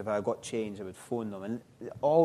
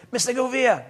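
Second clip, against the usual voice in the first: barking sergeant major; 12 semitones higher, 7 dB louder than usual. Retold or imitated. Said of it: "Sergeant Major McGregor said".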